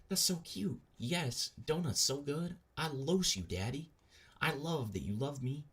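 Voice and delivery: put-on high-pitched cutesy girlfriend voice; mock gushing girlfriend. Streamer reading girlfriend's love texts, mock gushing.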